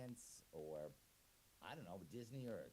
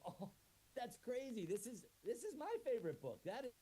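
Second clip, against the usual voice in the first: higher, louder delighted gush; excited surprise; 12 semitones higher, 5 dB louder than usual. Acted. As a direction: gush over the shared favorite. Finding shared favorite book.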